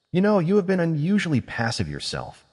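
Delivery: happy, a little smug; lightly voiced young witch. A narrator's reading. Witch remarks on Zorian.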